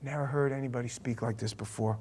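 very soft and low; awed hush. Acted. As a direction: murmur it in wonder. Awed repetition of the verse.

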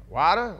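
"Water" as a baffled question, open "wah" vowel, pitch jumping up; puzzled WATER. Retold or imitated. Server still puzzled.

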